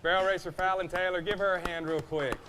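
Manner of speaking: booming arena announcer intro. Acted. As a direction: present her to the crowd.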